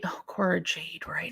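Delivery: soft whisper, to herself; whispered recall. Real, not acted. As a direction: breathe the name as it comes back to you. Quietly recalling a forgotten name.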